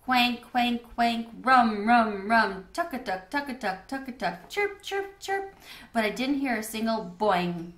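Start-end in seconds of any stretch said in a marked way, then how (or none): 0.1-2.7 louder croaking frog calls; frog croaks. A narrator's reading. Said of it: "so many different sounds". 4.5-5.5 high chirping frog calls; chirping frogs at night; frog chirps. A narrator's reading. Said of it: "so many different sounds".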